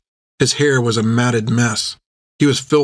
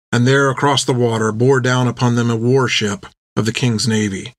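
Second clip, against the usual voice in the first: ordinary delivery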